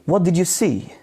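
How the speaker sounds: starts high, then drops on "see"; falling intonation. Shown as a demonstration. Teacher shows students a W H question, falling intonation. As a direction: let "see" fall away at the end. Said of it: "W H questions are falling intonation".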